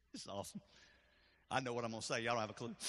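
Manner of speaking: almost too moved to speak; real tears held back; overwhelmed. Real, not acted. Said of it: "I was a little overwhelmed".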